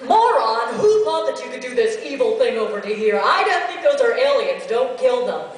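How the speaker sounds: loud furious demo character; angry character. Shown as a demonstration. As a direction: berate the fool at full volume. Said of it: "now that I wish that I had brought it".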